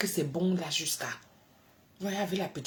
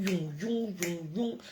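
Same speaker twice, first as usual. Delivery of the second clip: imitated ear noise; slower than usual. Shown as a demonstration. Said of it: "Les oreilles font comment?".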